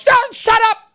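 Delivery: put-on high, sharp accented scolding; hammed-up mom cutting him off; angry mother. Mother demands to inspect son's eyes, high angry accent. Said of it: "in her anger".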